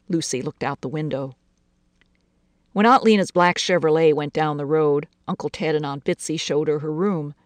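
plain reading voice

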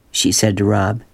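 ordinary delivery, nothing marked

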